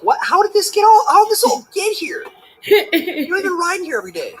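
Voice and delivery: mock indignant spouse. Husband voicing himself finding the passenger seat moved, high mock outrage. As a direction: play the baffled driver finding the seat moved.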